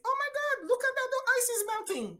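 high, loud astonished cry at the melting ice; stunned delight at melting ice; amazed exclamation. Retold or imitated. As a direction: exclaim in wonder, pitch way up. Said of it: "I remember saying".

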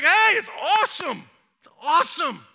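high, loud, exultant cheer; acted delight. John the Baptist answering worried followers, acted delight.

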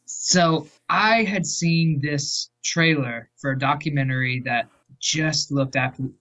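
usual speaking voice

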